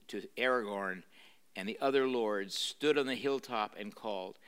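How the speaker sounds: ordinary delivery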